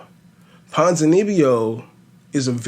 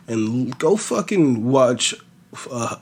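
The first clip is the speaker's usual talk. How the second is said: usual speaking voice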